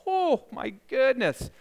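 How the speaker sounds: mock dismay, high and drawn out; shocked reaction. Preacher voices listeners reacting to a hard saying, mock shock.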